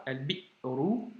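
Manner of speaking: slow al-bi'ru, cut short after "bi"; drawn-out demo of "Al-bi'ru" with a catch after "bi"; hamza blocked. Shown as a demonstration. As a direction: say "Al-bi'ru" syllable by syllable, stopping hard on the hamza.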